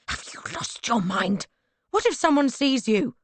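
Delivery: rising to a high, loud alarmed hiss; shocked he would risk being seen. Acted. Alarmed at his visit.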